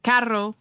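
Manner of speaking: "carro" with a Spanish trilled double R; rolled R. Shown as a demonstration. Co-host shows streamer how to roll R's, trilled "carro". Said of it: "how rolling the R's work".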